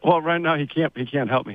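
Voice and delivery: ordinary talk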